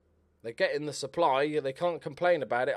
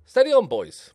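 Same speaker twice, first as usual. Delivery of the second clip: a sudden yell from the touchline, much higher; loud call to the team; 10 semitones higher, 11 dB louder than usual. Real, not acted. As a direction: shout it much higher and louder at the players. Calling out to his team.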